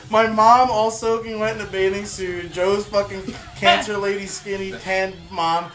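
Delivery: ordinary talk, nothing marked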